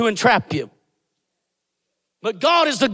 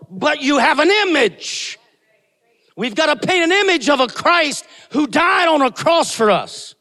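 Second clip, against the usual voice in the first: ordinary delivery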